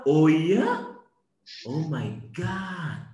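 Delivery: mock-fascinated, over-the-top listener; feigned amazement. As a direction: pretend to be hugely impressed by wife. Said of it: "pura-pura".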